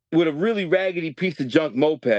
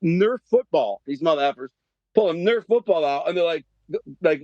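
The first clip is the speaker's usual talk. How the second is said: normal talking voice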